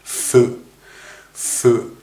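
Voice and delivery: ふ pushed out as voiceless friction through teeth and lip; hissed F demo; English F demo. Shown as a demonstration. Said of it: "これとこれが接してないといけません". (歯と唇をつけて「ふ」)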